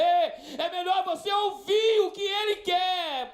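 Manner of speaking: high, slow, shouted warning; preaching shout. Real, not acted. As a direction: shout it at the congregation.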